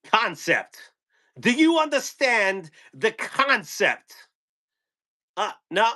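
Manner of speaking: high, slow, halting Walken voice; Christopher Walken impression. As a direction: lean into the odd Walken pauses.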